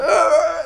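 high mock grunt. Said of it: "they were like".

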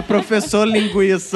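slow cartoon kid voice; drawn-out TV catchphrase; Chaves imitation. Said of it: "Tipo Chaves, quando fala isso aí".

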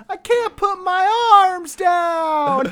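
put-on high, slow whine of a little kid; whiny bundled kid. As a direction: whine like a kid stuck in layers. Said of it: "he's like".

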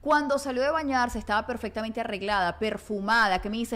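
ordinary talk, nothing marked

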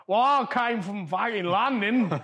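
hammed-up wrong English accent through a laugh; a lightly voiced mock Englishman, slow and laughing; deliberately bad English accent. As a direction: play a London accent badly on purpose, laughing. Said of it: "anytime I do an English guy".

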